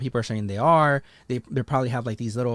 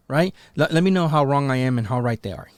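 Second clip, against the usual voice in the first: normal talking voice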